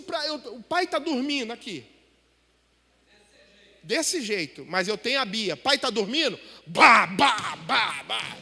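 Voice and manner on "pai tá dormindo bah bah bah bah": imitated loud banging.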